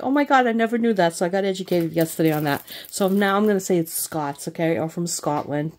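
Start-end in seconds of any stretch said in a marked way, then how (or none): 0.0-1.1 mock surprise. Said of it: "And I'm like".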